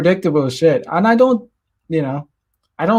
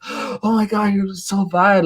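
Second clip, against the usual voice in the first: higher, gasping oh my God; mock shocked girl; 5 semitones higher, slower than usual. Date gasping at his dumb joke, mock shocked. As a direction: play the scandalized date.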